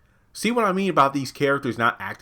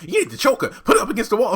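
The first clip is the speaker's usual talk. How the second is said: higher, louder hammed-up ranting; mock rant; 12 semitones higher, 6 dB louder, faster than usual. Reviewer retelling Maurice's wild advice to Calvin as a raised, ranting voice. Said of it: "he goes into one of his rants".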